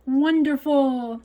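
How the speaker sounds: drawn-out, high, loud delight; delighted welcome. A narrator's reading. Grandma welcomes Lena to cook with her, delighted. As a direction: stretch it out with loud, high joy.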